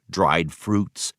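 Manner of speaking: usual speaking voice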